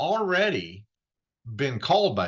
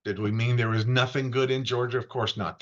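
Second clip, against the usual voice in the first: ordinary talk, nothing marked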